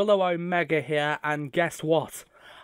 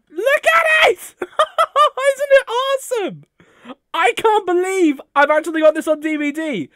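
high, loud screaming with joy; ecstatic shouting; 13 semitones higher, 7 dB louder than usual. Real, not acted. Fan unboxing a gift from Uncle Craig, ecstatic high screaming. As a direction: shriek like a fan who just got the gift.

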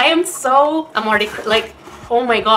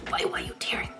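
whisper; 14 dB quieter, much faster than usual. Real, not acted. Whispering to herself while teary.

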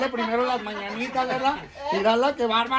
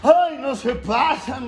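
ordinary delivery

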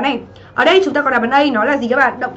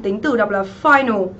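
ordinary talk

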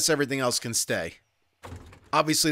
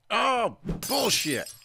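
disgusted; 8 semitones higher, much slower than usual. Real, not acted. Frustrated at empty loot.

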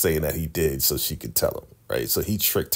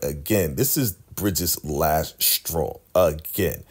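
ordinary talk, nothing marked

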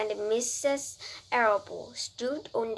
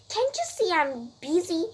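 higher-pitched, mildly annoyed retort; mildly disgusted; 7 semitones higher than usual. A narrator's reading. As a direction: brush off mother with a touch of disgust. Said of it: "replied Avery in disgust".

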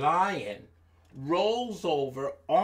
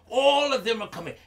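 usual speaking voice

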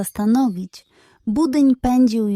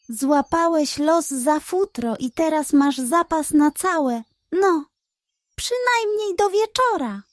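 smug pug; 6 semitones higher than usual. Acted. Pug talks to himself.

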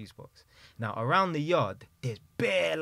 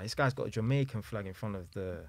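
ordinary talk

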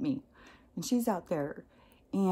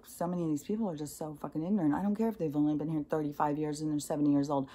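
ordinary talk, nothing marked

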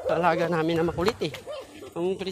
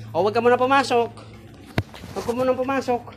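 loud, raised-pitch order; insistent raised voice; firm command; 6 semitones higher, 7 dB louder than usual. Real, not acted. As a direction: call out to stop the puppy going in. Ordering puppy not to enter.

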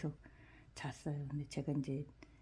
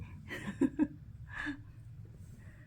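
high giggle; 11 semitones higher than usual. Real, not acted. Laughing at the dog.

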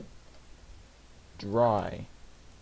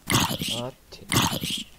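ordinary talk, nothing marked

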